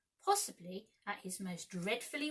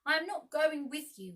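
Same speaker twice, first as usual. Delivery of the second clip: stern; 7 semitones higher, 7 dB louder than usual. A narrator's reading. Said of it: "Peter looked stern".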